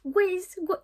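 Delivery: high-pitched mock call of a name; imitating the boyfriend. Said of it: "il parlait comme ça". (imitation du copain, voix haut perchée)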